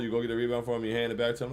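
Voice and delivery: ordinary delivery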